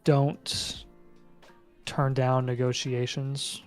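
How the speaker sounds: usual speaking voice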